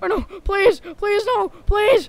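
high, loud frantic begging; anguished denial. Acted. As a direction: plead desperately, rising to a scream. Ragdoll begging before explosion.

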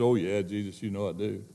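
soft reply. Acted. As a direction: answer gently, almost under breath. Peter answering Jesus softly.

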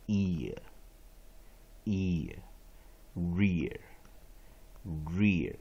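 "ear" and "rear" drawn out very slowly, gliding from ee into a schwa; pronunciation of "ear". Shown as a demonstration. Teacher showing how the IA ending is read, slow demonstration of "ear". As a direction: stretch "ear，rear，rear" slowly, each one separate. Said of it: "一定是念ear".

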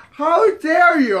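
high, loud, slow indignation; mock outraged. Offended woman to man hitting on her friend, mock outrage. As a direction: imitate an affronted woman scolding.

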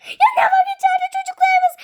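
high-pitched tearful cry; panicked parent. Pretend. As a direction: play a parent wailing for the children. Frightened parent fearing for children. (panik içinde feryat)

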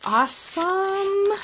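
high, slow, rising coo of approval; drawn-out delight. Real, not acted. Crafter finds little pins in gift, high drawn-out delight.

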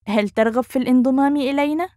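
quick, fluent rising question; natural speed. Shown as a demonstration. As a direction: run the question together, lifting upward. Teacher repeats phrase at speed.

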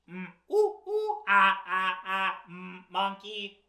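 robotic monkey hoots in a monotone. Acted. Reader being the book's robot monkey, robotic monkey noises. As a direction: hoot evenly like a mechanical monkey. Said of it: "I am a robot monkey".

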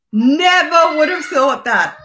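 loud, drawn-out disbelief; astonished. Real, not acted. Reacting to news that she's Mexican, astonished. Astonished at learning her background.

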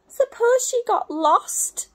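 high, loud, slow fearful owl; worried owlet. Acted. Sarah fears mother got lost, high worried. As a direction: fear the worst out loud. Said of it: "said Sarah".

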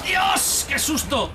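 sudden high scared yell; startled shout. Real, not acted. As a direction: jump at the scare. Jump scare in game.

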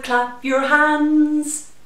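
usual speaking voice

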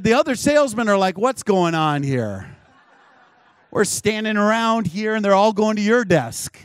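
high, loud mock complaint; exasperated group protest, acted out; retold loud bafflement. Retold baffled rival salesmen.

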